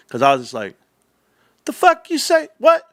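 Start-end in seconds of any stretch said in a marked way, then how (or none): 1.7-2.8 retelling how he blew up at a fake insider, pitched way up; going off. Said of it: "And I just went off".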